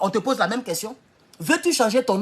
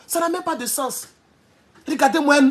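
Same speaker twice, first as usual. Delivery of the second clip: usual speaking voice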